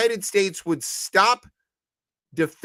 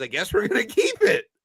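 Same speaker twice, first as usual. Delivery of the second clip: smug shrug; 6 semitones higher than usual. Retold or imitated. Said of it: "he goes".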